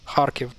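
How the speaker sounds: Kharkiv as KHAR-kiv, first syllable stressed; correct pronunciation. Shown as a demonstration. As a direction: open Kharkiv on a breathy kh and stress it. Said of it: "that's the right way".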